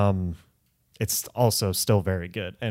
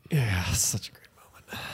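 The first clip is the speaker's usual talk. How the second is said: unvoiced gloating whisper; 6 dB quieter than usual. Real, not acted. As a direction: whisper it with a smug grin. Teasing whisper to friend.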